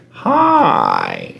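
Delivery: long drawn-out greeting, sliding down low; creepy parrot. Retold or imitated. Parrot senses someone walking at night, creepy. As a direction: draw it out like an eerie bird in the dark. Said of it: "He's like".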